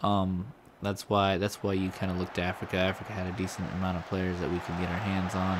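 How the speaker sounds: ordinary talk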